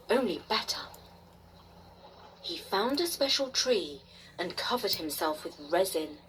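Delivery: ordinary delivery, nothing marked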